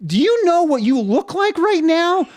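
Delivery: retold teasing at a friend; teasing question. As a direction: rib your friend about his look. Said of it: "and then you go".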